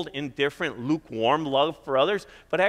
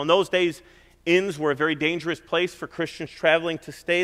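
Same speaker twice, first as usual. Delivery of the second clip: usual speaking voice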